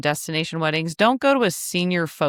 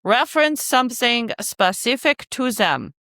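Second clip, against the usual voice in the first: playful mock-German accent, pitched up; pretend German accent; 4 semitones higher than usual. Host reading out her second rule, in a pretend German accent. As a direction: put on an unsure, makeshift German accent. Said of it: "I'm pretending it's German".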